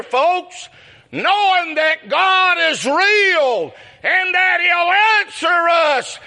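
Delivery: rising, slow shouted preacher's cry; preaching at full force. Acted. Preacher rallying his congregation, full shouted preaching. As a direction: build it up and shout it out to the church.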